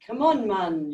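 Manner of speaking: light imitation of a Caribbean accent. Shown as a demonstration. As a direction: try a quick Caribbean lilt. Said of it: "a Caribbean accent".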